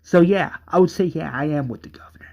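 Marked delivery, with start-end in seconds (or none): 1.3-2.3 whisper. Acted. Brother declaring loyalty.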